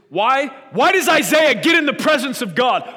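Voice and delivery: shouted, high, impassioned sermon; full-force preaching. Real, not acted. Preacher asks why Isaiah saw God's glory, impassioned shouting. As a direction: thunder out the questions at full voice.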